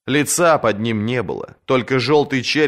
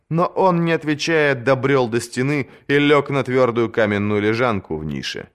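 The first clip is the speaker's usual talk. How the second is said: plain reading voice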